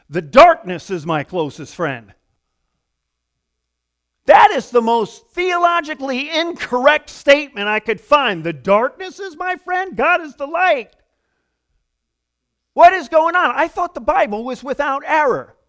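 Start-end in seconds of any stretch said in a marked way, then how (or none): 4.3-8.4 loud, high, incredulous protest; mock outrage, almost shouted; indignant outburst. 8.4-10.9 high-pitched, indignant disbelief; raised voice, scandalized and disbelieving; incredulous protest. Acted. Incredulous repeat of psalm's last line. 12.7-15.6 loud, high, indignant bafflement; worked-up, scandalized complaint; shocked protest. Acted. Acts shocked Bible reader.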